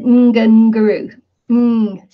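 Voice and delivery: "Ngunguru" led by a hummed mm; mistaken newcomer pronunciation. Shown as a demonstration. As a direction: hum into the hard g's of "Ngunguru". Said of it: "saying mm".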